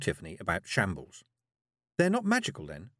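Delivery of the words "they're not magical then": put-on higher, curious girl. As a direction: ask like a curious child. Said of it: "said Tiffany".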